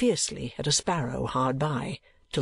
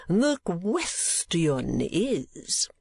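hugely drawn-out, higher-pitched words; slow, theatrical old oddball; eccentric old gentleman; 4 semitones higher, much slower than usual. Acted. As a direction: stretch it out portentously. Old gentleman's grand, mysterious opening.

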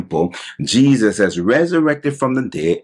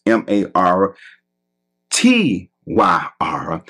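spelled out; 2 semitones lower than usual. Shown as a demonstration. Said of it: "Let me spell that for you".

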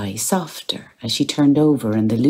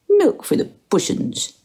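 much higher coaxing pet talk; coaxing the cat. A narrator's reading. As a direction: coo at the cat.

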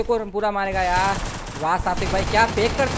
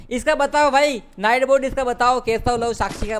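louder, higher, slowed teasing call; teasing shout; 5 semitones higher, 5 dB louder than usual. Acted. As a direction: call it out to tease the chat. Teasing streamer calls for answer.

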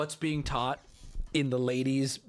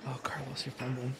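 low, whispered aside; hushed dismay; 5 semitones lower, 9 dB quieter than usual. Real, not acted. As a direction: whisper it as if coaching him secretly. Aside urging the man on the date.

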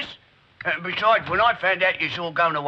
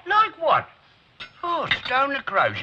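exasperated; 9 semitones higher, slower than usual. Acted. Exasperated at Alice's shame.

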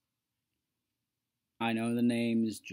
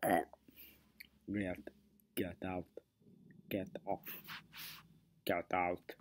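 throat-clogged Terminator voice; 4 semitones lower, 6 dB quieter than usual. Shown as a demonstration. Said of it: "sound like you're clogging up your throat".

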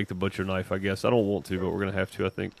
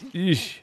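wincing eesh; disgusted eesh; 8 semitones higher than usual. Real, not acted. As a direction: recoil in disgust. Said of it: "I'm glad they blocked this out".

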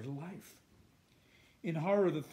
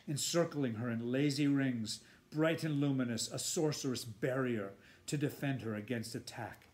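ordinary delivery

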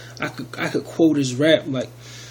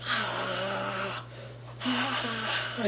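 high, soft retold cry of pain; a faint, high imitated wail; mock anguished cry; 6 semitones higher, 9 dB quieter than usual. Describing a soul in lava, a soft high mock cry. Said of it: "and just scream".

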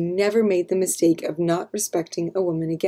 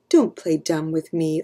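ordinary delivery

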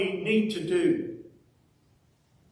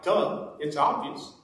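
mocking duh; 3 semitones lower, 4 dB quieter, slower than usual. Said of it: "he's become famous with this".

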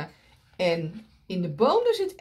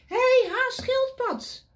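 high, loud bouncy rabbit call; eager rabbit; 4 dB louder than usual. Acted. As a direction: call out like an excited little rabbit. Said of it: "Vraagt Konijn".

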